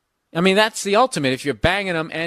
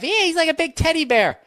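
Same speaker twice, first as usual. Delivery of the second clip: high mocking girly voice; high mock gush; mock girl; 11 semitones higher, 3 dB louder than usual. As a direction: mimic the girls gushing, pitched way up. Mocking women's talk.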